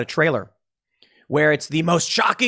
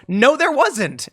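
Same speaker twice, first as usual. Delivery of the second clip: mock fact checker; 9 semitones higher, slower than usual. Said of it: "PolitiFact goes".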